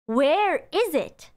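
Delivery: slow, drawn-out English gloss; slow translation. Shown as a demonstration. Teacher slowly glosses the question for learners. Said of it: "If you say".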